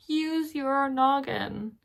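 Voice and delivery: mock-pleading repeat; exasperated. Real, not acted. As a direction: plead for common sense, drawn out. Repeated, more emphatic.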